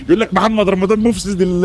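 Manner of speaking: usual speaking voice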